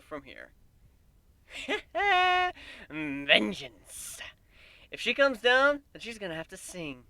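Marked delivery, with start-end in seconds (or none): 2.9-4.2 gloating laugh. A narrator's reading. Gloating over Celestia.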